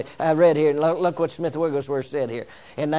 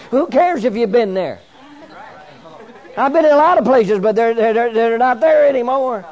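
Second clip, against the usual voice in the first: loud, high-pitched revival preaching; rally the congregation, voice raised high; full-force preaching; 6 semitones higher, 7 dB louder than usual. Real, not acted. Preacher wishing for a friend in this church tonight, full-force preaching.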